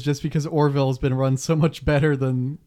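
ordinary talk, nothing marked

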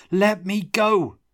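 high, loud and slow; shout. A narrator's reading. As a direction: yell it while pulling free. Tomas struggling against Brody's grip.

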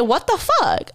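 high, stunned "what the fuck"; disbelief. Retold or imitated. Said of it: "I'm like".

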